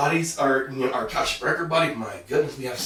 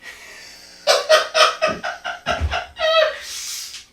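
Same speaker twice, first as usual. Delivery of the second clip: airy high laugh; breathy embarrassed laugh; 7 dB louder than usual. Real, not acted. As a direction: laugh off the slip. Laughs after cutting the mishap.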